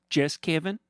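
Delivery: high, sugary mock-friendly villain voice; smug villain Fernando. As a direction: purr at him like a gloating rich villain. Fernando irritated.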